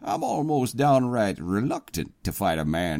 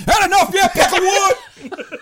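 much higher, louder mocking falsetto taunt; 10 dB louder, faster than usual. The president taunting the knocked-down captain, mocking falsetto. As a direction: jeer at the beaten captain in a squeaky voice.

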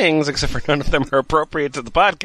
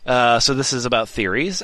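ordinary talk

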